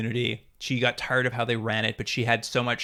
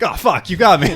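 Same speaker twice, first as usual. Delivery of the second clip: loud, higher-pitched hammed-up surrender; mock exasperated outburst; 6 semitones higher, 11 dB louder than usual. General to the woman arresting him, mock exasperated outburst. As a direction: burst out as if just caught. Acting out the general getting caught.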